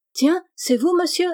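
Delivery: cheerful. A narrator's reading. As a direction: greet him brightly as if by chance. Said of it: "dit gaiement Estelle".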